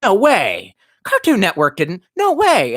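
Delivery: disbelieving, voice shooting up at the end; stunned disbelief. Retold or imitated. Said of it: "And I'm sitting there watching it going".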